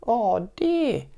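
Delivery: high, squeaky little cub; baby bear voice. Acted. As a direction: cry it like a tiny cub. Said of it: "cried the baby bear in his wee little voice".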